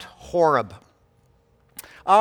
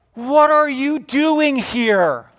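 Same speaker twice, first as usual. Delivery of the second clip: high, loud, slow demand; God calling someone to account; booming challenge; 8 semitones higher, 6 dB louder, slower than usual. Acted. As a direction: stretch out a pointed question from the pulpit. God challenges Elijah, acted by preacher.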